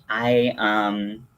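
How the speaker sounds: ordinary delivery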